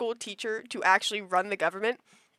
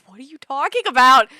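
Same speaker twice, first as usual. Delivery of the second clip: raised, insistent question; louder puzzled mom; 3 semitones higher, 9 dB louder than usual. Retold or imitated. Mom demanding to know what her child means. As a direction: ask it again, pushing harder.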